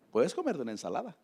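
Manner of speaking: normal talking voice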